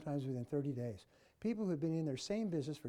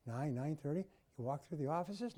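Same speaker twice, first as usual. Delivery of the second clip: ordinary talk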